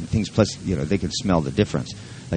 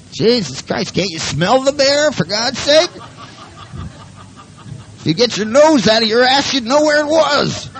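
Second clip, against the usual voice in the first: barking dog talk; 5 dB louder than usual. Acted. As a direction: scold the human in a very high dog voice. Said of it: "they're barking".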